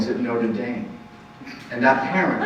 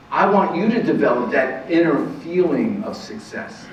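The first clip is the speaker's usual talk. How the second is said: ordinary talk, nothing marked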